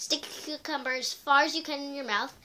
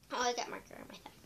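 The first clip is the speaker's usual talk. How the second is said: whispered aside; 13 dB quieter, much faster than usual. Real, not acted. Aside about marker.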